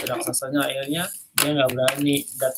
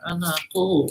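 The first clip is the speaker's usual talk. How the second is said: higher, sweet fake granny voice; giant faking grandmother; 5 semitones higher, slower than usual. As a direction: coax sweetly through the door. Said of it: "Raksasanya bisa meniru suara ibunya".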